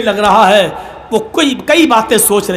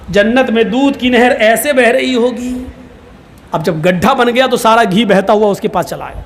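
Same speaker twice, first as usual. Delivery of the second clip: normal talking voice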